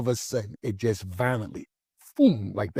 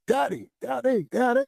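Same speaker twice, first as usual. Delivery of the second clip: put-on much higher childlike calls of Daddy; imitating daughter yelling; 13 semitones higher than usual. Daughter tries to wake her father, mock childlike call. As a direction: mimic a little girl calling her sleeping dad. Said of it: "So she's yelling".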